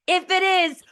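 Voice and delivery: high, loud exasperated cue; screaming into mic. Acted. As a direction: belt it at a student who won't listen, hammed up. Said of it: "I'm screaming into my mic".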